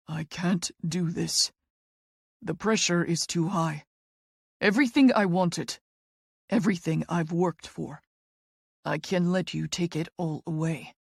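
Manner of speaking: broken. A narrator's reading. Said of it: "He sounds so deeply broken".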